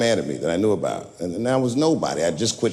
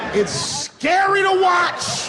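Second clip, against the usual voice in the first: scary; much slower than usual. Acted. Building outrage about Trump.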